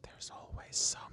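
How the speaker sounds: fast, whispered under the breath; whispered aside. Real, not acted. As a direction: grumble it unheard. Unvoiced aside.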